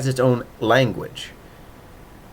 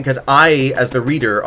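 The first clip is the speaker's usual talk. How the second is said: ordinary talk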